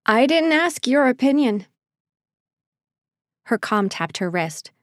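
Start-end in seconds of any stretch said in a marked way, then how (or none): 0.1-1.7 higher, louder, fighting to stay steady; strained steady voice. A narrator's reading. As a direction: hold back anger, keep the voice firm. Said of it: "she fought to steady her voice".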